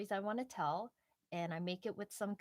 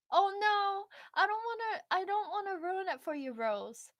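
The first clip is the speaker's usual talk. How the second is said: mock panic; 10 semitones higher, 6 dB louder than usual. Real, not acted. Catching herself before spoiling.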